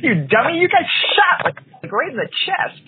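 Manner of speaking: high, incredulous mock scolding; exasperated retort. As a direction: squeak out disbelief at a dummy. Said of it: "I'm like".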